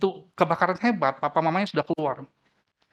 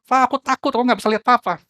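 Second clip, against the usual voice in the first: high, loud, panicky cries; frightened child; 6 semitones higher, 5 dB louder, faster than usual. A narrator's reading. Trapped child shouts down to father, terrified. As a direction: cry out in fear from a burning roof. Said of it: "Anaknya jawab".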